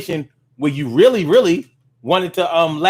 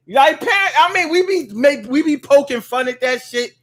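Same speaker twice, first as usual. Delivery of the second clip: raised, teasing voice; high mock complaint to a parent; 8 semitones higher than usual. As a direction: poke fun up high.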